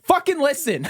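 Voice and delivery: high-pitched mock exasperated shout; exasperated yell. His lady after six "what"s in an hour, exasperated yell. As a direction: imitate a fed-up girlfriend yelling. Partner snaps at him, retold.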